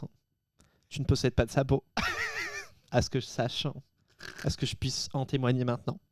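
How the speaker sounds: lightly voiced solemn guru; mock guru voice. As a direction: preach it like a mock wise guru. Mock guru pep talk.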